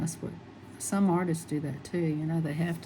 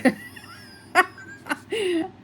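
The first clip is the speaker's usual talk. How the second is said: a high squealing laugh that nearly cries; high laughing fit; 12 semitones higher than usual. Real, not acted. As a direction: burst into a high, helpless laugh. Laughing at the retold story.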